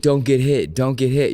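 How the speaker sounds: loud, high mock boxing-coach yell; mock coach scream. Imitating a boxing coach at his fighter, mock screaming. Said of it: "who's just screaming at you".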